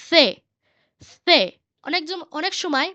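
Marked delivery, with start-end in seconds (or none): 0.1-0.2 isolated "সে", lisped th as in thin; Castilian C sound. Shown as a demonstration. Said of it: "কাস্তেজানোর যে উচ্চারণ অন্যরকম". 1.2-1.4 isolated "সে", lisped th as in thin; Castilian C sound. Shown as a demonstration. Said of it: "কাস্তেজানোর যে উচ্চারণ অন্যরকম".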